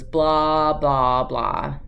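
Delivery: droning blah blah, sinking down; mock dismissive blah, trailing off; bored blah blah. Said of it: "all he heard, I think, was".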